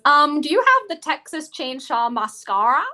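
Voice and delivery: loud, high, badly faked Australian; exaggerated amateur Australian accent. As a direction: do a hammed-up Australian accent, rising into a question. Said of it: "My really bad Australian accent".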